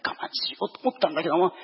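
ordinary talk

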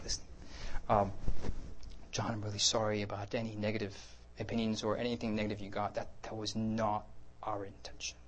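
soft, sincere apology, fading to near whisper; really quiet, gentle. Retold or imitated. Preacher showing how he apologized to John, gently hushed. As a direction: apologize gently, barely raising the voice. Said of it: "I'm talking really quiet now".

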